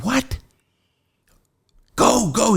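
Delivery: high astonished yelp, then urging; shocked cellmate; disbelieving cry. Retold or imitated. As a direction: gasp out the question, then push him out.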